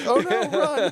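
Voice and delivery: high, loud squeal of comic alarm; mock panic. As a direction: yelp it like fleeing in jest. Mock reaction to love confession.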